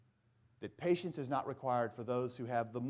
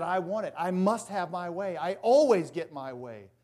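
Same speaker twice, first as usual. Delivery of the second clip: spoiled screaming; 3 semitones higher, 7 dB louder than usual. Retold or imitated. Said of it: "who's screaming that".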